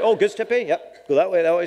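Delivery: high, quick helpful local giving directions; lightly voiced helpful local; quoting a local. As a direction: point the way like a cheerful passer-by. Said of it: "So like".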